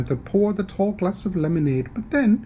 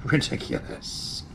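whispered scoff; 5 dB quieter than usual. Acted. Dismissive whispered aside.

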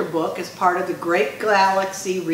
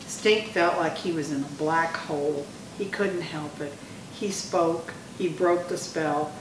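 soft, suspenseful telling; hushed narration; 7 dB quieter than usual. A narrator's reading. Hushed narration at mock funeral.

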